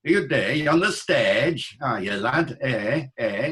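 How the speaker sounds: imitated Yorkshire with long flat "eh" vowels; playful Yorkshire dialect demonstration; Yorkshire accent. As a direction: put on a broad Yorkshire accent. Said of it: "They say it up in Yorkshire".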